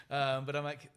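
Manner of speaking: normal talking voice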